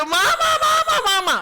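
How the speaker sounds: put-on very high, loud panicked child calling mama; a small child seeing the syringe, screaming for his mother; child crying for mama. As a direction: call for mom like a terrified toddler.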